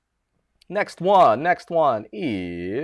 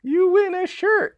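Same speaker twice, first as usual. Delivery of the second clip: excited announcement; 7 dB louder than usual. Real, not acted. Announcing a raffle winner.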